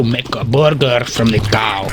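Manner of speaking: normal talking voice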